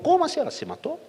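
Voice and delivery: high, stunned gasp of a question; shocked disbelief. Retold or imitated. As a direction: react as if the news just hit you. Pastor hears the girl is dead.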